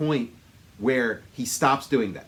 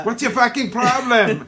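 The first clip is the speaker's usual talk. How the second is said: higher, louder mock Austrian accent; Schwarzenegger; 8 semitones higher, 7 dB louder than usual. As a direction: play it big with a comic Austrian accent. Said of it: "he talks the way he does".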